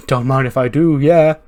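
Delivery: hammed-up brash acceptance of a fight; a wrestler's swaggering yell; cocky mock shout.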